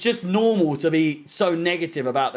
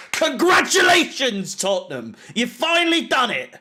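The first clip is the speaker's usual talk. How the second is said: sarcastic congratulations; 4 semitones higher than usual. Real, not acted. Fan bitterly congratulating Tottenham, sarcastic cheer. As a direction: hammer up the praise, pitch leaping. Sarcastic praise of the rival team.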